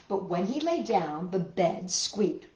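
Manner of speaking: ordinary delivery, nothing marked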